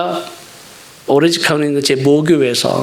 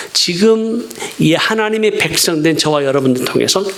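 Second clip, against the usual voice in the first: ordinary talk, nothing marked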